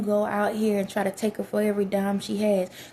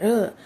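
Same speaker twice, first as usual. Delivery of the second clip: rough grunt; 5 dB louder than usual. Retold or imitated. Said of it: "You need somebody that's like".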